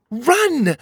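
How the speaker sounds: high-pitched cry of warning; high, urgent command; urgent shout. A narrator's reading. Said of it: "Cogleen shouted to them".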